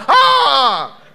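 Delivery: long, loud high cry; mock amazed scream. Church member hearing mother's name, mock amazed scream. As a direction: let out a drawn-out hammed-up shriek.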